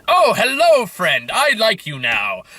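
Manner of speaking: sudden sugary high greeting, then slow; a hammed-up friendly video game character; mock sweet NPC greeting. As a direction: play a game character gushing fake affection. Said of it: "it's like".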